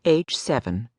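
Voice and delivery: ordinary delivery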